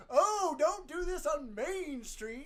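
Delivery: high, loud and slow nagging local; mock scolding. To the co-host, mocking locals who fuss about Main Street, high and loud. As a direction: ham up the fussy townsfolk warning. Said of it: "Everybody's just like".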